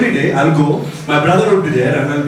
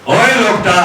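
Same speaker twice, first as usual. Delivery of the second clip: hard-of-hearing dad calling out; 3 semitones higher than usual. Retold or imitated. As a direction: call out as if nobody can hear. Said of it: "always be at the top of the voice".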